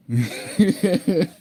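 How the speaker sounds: high giggle fit; helpless laugh breaking the attempt; laughing instead of reading; burst of laughter. Real, not acted. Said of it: "I can't even do it".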